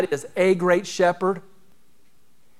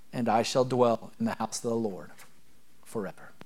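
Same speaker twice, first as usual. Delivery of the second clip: reverent psalm reading; 4 dB quieter than usual. Acted. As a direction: let each word land gently, almost hushed. Paraphrases psalm close, reverent.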